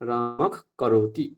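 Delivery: রামক ends in a drawn-out throat খ before করতি; jihvamuliya pronunciation. Shown as a demonstration. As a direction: slowly turn the breath after রামক into a kh. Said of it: "জিহ্বামূলীয়". (জিহ্বামূলীয় উচ্চারণ)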